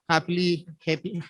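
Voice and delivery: usual speaking voice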